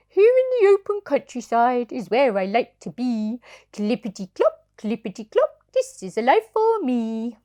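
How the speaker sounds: a contented toy horse singing his joy; rocking horse voice. Acted. Parent reading to child as happy rocking horse. Sugar Lump speaks.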